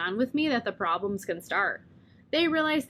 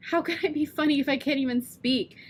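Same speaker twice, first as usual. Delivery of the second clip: ordinary delivery, nothing marked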